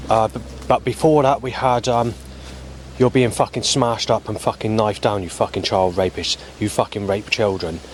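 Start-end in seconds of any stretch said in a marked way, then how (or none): none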